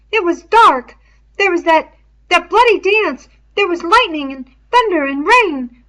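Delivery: put-on high, loud, agitated boy's voice; a scared boy rushing through excuses; Piggy, frightened boy. A narrator's reading. Piggy standing over Ralph, explaining the night in a high agitated voice.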